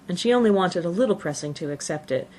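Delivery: plain reading voice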